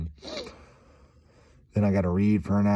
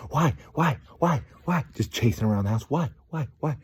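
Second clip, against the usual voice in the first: put-on repeated childish whys; light kid voice chasing mom; nagging kid; 6 semitones higher than usual. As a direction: pester like a toddler. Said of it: "starts chasing around".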